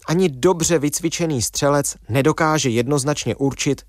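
ordinary talk

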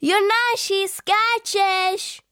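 high, loud call across the yard. A narrator's reading. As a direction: shout his name to get him to jump. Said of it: "Volají na něj kluci i trenér".

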